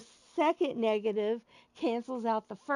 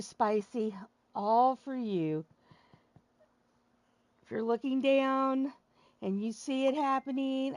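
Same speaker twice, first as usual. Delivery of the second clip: ordinary talk, nothing marked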